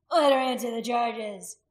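loud, slow demand; joining the shout. A narrator's reading. Man backing the warrior's demand, loudly. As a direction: call out firmly for an answer. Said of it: "Haragast joined him".

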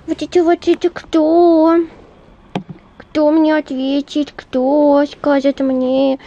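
high, loud cooing at a pet. Real, not acted.

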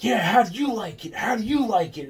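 high, gloating mock taunt from Jimmy. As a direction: sneer it up high like rubbing it in. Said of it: "he's like".

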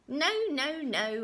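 high, loud, dancing no-no-no; gleeful refusal. A narrator's reading. Little man dances round the room, gleeful. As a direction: crow it triumphantly. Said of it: "and he laughed and danced".

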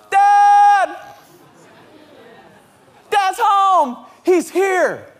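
put-on high, loud kid's cheering; excited children. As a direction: shout it like a kid greeting a returning father. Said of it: "the sound of them excited I'm home".

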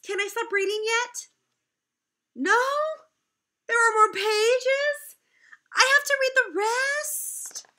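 high, loud pretend dismay; mock whining. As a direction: whine like a kid facing homework.

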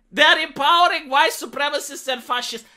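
normal talking voice

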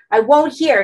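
pained, forceful protest; anguished refusal. A narrator's reading. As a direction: cry out against the voice in your head. Character refusing voice in agony.

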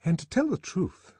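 put-on high-pitched girlish character voice; high, chatty admission; confiding young woman. A narrator's reading. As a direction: confide airily in a friend. Lenina confides in her friend.

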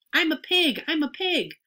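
high-pitched playful boast; excited boy. A narrator's reading. Boy on all fours in the crate, excited boast. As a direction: crow it high, like a kid playing pig.

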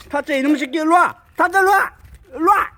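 loud call to the dog. Real, not acted. Owner calling his dog loudly. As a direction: call the dog's name across the yard.

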